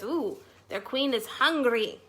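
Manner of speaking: ordinary delivery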